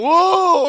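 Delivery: loud, high wailing "ooh"; imitated prayer moan. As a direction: reenact a long moan before God. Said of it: "I was a moaning before God".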